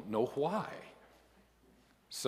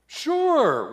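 high, loud, caught-out yelp; startled yes; 11 dB louder than usual. A narrator's reading. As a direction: blurt sure in alarm. Caught out by God.

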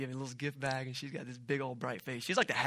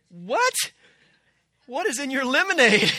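much higher and louder, laughing in disbelief; astonished laughing; 11 semitones higher, 15 dB louder than usual. Retold or imitated. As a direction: blurt it out amazed, laugh breaking in. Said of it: "I'm like".

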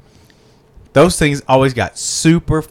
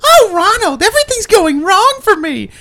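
much higher, whiny mock complaint; whiny Birdie. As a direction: whine like a helpless cartoon bird. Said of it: "I just said, "I hate Birdie".

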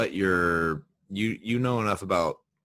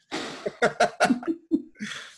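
high giggle. Real, not acted. Giggling at his visuals.

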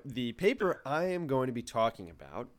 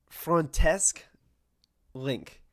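name said with a French accent, louder and higher; 5 semitones higher, 6 dB louder, much slower than usual. Shown as a demonstration. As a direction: give the name a French flair. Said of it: "I can't say Link with a French accent".

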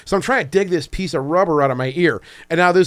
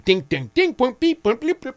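higher mimicked dings and beeps; playful imitation of a buzzing phone; phone notification noises; 10 semitones higher than usual. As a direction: voice the alerts going off one after another.

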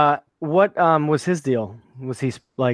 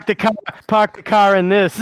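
put-on higher, laughing Boston accent with dropped R's; mock Boston accent; 5 semitones higher than usual. Friend riffing on Boston Powers, mock Boston accent. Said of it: "a Boston accent sounds like".

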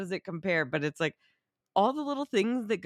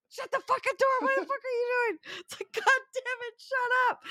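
high, hammed-up shrieking yell; imitating a screaming director; mock director rage.

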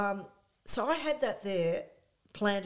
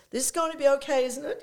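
put-on a light English accent, anxious and louder; 7 semitones higher, 6 dB louder, faster than usual. Nervous star in the car asking his manager, English accent. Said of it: "his very English accent".

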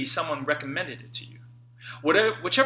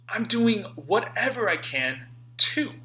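ordinary talk

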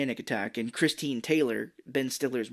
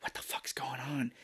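hushed, voiceless aside; baffled whisper; acted whisper; 11 dB quieter than usual. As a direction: whisper it in bewilderment. Acting out bewildered girl's aside.

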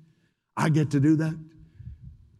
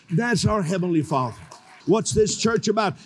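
ordinary delivery

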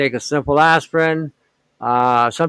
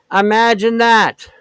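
sarcastic; 7 semitones higher, slower than usual. Real, not acted. Sarcastic remark on babies dying.

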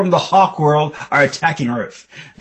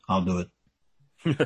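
low, soft, very slow voice; volunteer low and drawn out; quiet, deep superhero deadpan; Batman; 8 semitones lower, 9 dB quieter, much slower than usual. Retold or imitated. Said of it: "Batman goes".

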